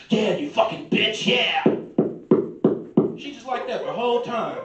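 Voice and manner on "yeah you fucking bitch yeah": loud, hammed-up dirty talk; mock porn growl. Porn actor talking dirty to his silent partner, loud and hammed-up. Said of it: "he going hard".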